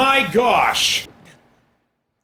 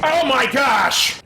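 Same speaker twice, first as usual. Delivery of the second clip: ordinary delivery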